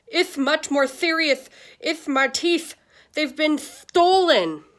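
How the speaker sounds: toothless grandpa voice. Acted. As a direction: talk as if you lost your dentures. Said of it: "Why is Grandpa talking funny?".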